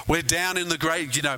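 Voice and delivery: usual speaking voice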